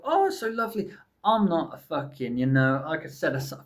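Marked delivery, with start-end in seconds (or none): 0.0-0.9 gushing. Real, not acted. Gushing over spring blossom.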